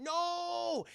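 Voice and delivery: long, high "no"; mock horrified cry. Jackie Chan's friend about to be shot, melodramatic cry. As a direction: wail it like a slow-motion movie moment.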